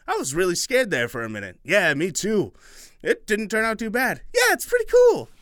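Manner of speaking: put-on high chattering children; relieved kids.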